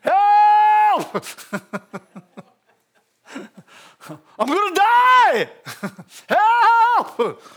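loud, high drowning man's yell; hammed-up cries for rescue; crying out. Shown as a demonstration. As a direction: holler as if about to drown. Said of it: "Cry out".

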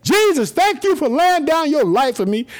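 high, ringing shout of thanks; shouted praise. Real, not acted. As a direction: shout it out to heaven, unashamed. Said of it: "I shout it".

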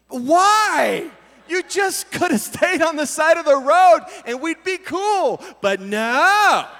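high, loud mock-anguished complaint; exasperated scolding. Driver to the deer lying in the road, exasperated scolding.

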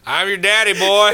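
high, loud Deep South father; mock Southern dad laying claim; hammed-up good ol' boy daddy. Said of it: "filmed instead in the Deep South".

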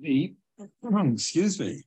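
throat clearing between words; choking on a pretzel. Real, not acted. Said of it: "I'm choking on a pretzel right now".

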